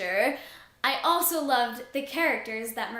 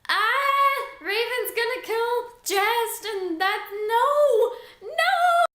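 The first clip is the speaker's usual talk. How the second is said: much higher and louder, frantic, ending in laughing cries of no; panicked shriek; 10 semitones higher, 5 dB louder, much slower than usual. Retold or imitated. Fearing for Jest in the maze, a frantic high shriek. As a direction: yelp in horror at the character, half laughing. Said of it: "I was like".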